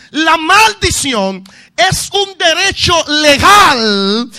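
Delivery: slow, loud shouted preaching; preacher warning the congregation loudly; preaching at full force. Acted. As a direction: hammer the point home. Preacher rises again.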